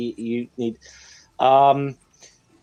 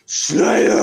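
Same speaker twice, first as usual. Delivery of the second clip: loud, raised, drawn-out concert yell; imitated metal-fan yell; 7 semitones higher, 5 dB louder than usual. Quoting a comedian's punchline to friends, metal-fan yell. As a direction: bellow it like a fan in the pit.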